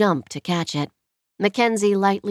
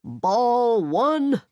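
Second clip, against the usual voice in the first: yelling; 4 semitones higher than usual. A narrator's reading. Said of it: "Anderson yelled as loud as he could".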